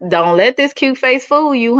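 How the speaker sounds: ordinary talk, nothing marked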